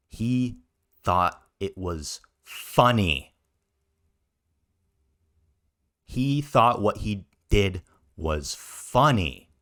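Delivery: slow and weighty, loud on "funny"; deliberate pauses, "funny" punched loud; emphatic disbelief. Real, not acted. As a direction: spell it out word by word, landing hard on "funny". Indignant emphatic repetition for effect.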